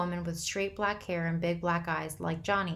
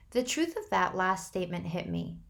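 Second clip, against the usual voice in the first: ordinary delivery, nothing marked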